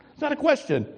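high, NOT hit hard; emphatic correction. Real, not acted. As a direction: insist on NOT. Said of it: "It's a statement, emphatically".

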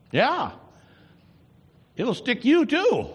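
high, loud preacher's warning; fiery preaching. Acted. Preaching at full force.

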